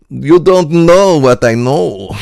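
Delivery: put-on high, loud, slow Eastern European accent; mock Russian, drawn out and loud. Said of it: "Eastern European".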